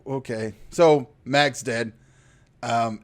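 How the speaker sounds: ordinary talk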